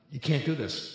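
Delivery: ordinary talk, nothing marked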